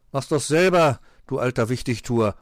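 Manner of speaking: loud, sulky retort; grumpy backtalk. A narrator's reading. Pechmarie snaps back at the fox, grumpy. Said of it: "motzte die Pechmarie".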